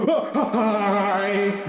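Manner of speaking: startled greeting. Retold or imitated. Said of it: "and I go".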